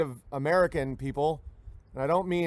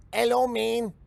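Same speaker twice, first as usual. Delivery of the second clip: higher mock Mexican accent; Mexican accent greeting; 7 semitones higher, slower than usual. Immigrant greeting the speaker, mock Mexican accent. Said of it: "if someone's like".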